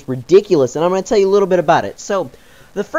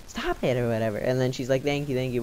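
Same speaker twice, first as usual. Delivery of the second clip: soft, muted; 4 semitones lower, 11 dB quieter than usual. Retold or imitated. Girlfriend's quiet lines.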